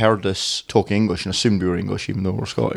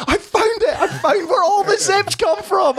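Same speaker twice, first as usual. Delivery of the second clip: squealing, much higher mock-excited cry with a laugh; retold excitement; slower than usual. Retelling spotting the zip factory in Romania, high laughing excitement. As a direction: relive the thrill of discovery, pitched way up. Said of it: "I was big excited".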